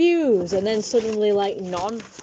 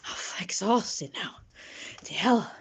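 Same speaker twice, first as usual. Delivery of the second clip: normal talking voice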